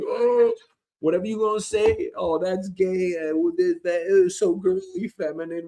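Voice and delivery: high-pitched mock complaint; mocking hater. Fan mimicking doubters of the band, mocking. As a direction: imitate a whiny objection, high.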